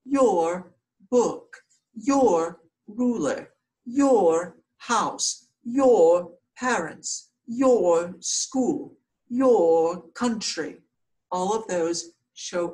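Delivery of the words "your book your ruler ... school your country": each "your" hit louder and firmer; teacher drilling "your" with emphasis; stressed your. Shown as a demonstration. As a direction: punch "your" in every example. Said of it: "Remember, it shows ownership".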